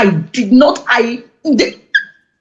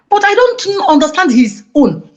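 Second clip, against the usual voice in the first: ordinary delivery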